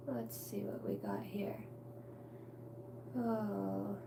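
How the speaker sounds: hushed whisper to herself; whispering while checking the screen. Real, not acted. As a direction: whisper it under your breath.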